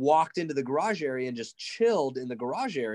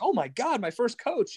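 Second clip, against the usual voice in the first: higher, delighted voice; excited recognition; 9 semitones higher than usual. Retold or imitated. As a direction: greet your first coach with joy. Alex voiced in retold story.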